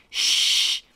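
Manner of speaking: loud shush. Retold or imitated. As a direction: shush back hard in play. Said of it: "I said".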